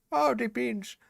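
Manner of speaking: put-on high, airy South African old man voice; Nelson Mandela impression. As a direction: quote it like a frail elderly statesman. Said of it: "[name] doing the Nelson Mandela voice".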